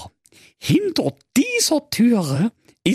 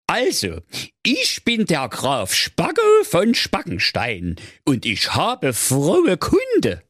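nochmal richtig; 9 semitones higher, slower than usual. Acted. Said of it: "ich fange noch mal an".

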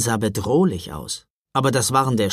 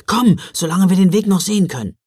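urging; 6 semitones higher, 6 dB louder, faster than usual. Acted. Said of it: "drängte Jonas".

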